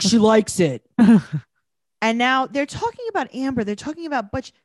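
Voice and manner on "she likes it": louder mock mother brushing it off; mock dismissive mom; imitating a mom. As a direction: toss it off like a careless parent. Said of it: "She's like".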